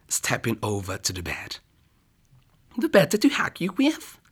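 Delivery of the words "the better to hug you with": much higher whispered granny voice; wolf as Grandma whispering. Acted. Wolf lures the girl to the bed, high whispered granny voice. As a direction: coax her closer in a fake granny whisper.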